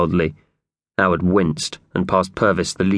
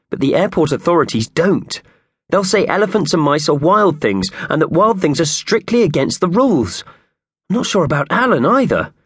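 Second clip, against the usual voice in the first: worried Howard; 9 semitones higher than usual. Acted. Narrator voicing Howard.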